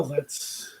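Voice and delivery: ordinary talk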